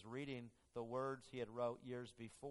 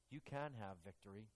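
gentle reminder; 7 dB quieter than usual. Retold or imitated. God reminding the struggling man, soft and tender. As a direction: speak it softly as comfort.

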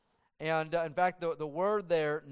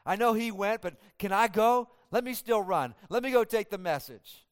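keen, a bit louder and higher, begging to go; eager pleading; 5 semitones higher, 5 dB louder than usual. Retold or imitated. Ahimaaz begging Joab to let him run, eager. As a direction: plead like a pumped-up runner. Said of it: "He, he's saying".

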